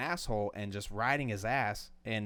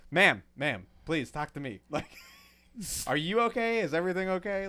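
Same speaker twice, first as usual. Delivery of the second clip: higher, louder mock-polite pleading; overly courteous, begging her to talk to you; 7 semitones higher, 5 dB louder than usual. Teller replays himself politely pleading with the lady, mock-polite. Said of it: "and I'm like".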